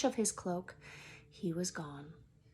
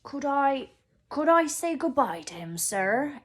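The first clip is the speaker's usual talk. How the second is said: higher, louder tearful giant's plea; Hagrid voice; 6 semitones higher, 9 dB louder than usual. Acted. Reader voicing Hagrid pleading to say goodbye, tearful. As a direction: beg to say goodbye, choked up. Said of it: "asked Hagrid".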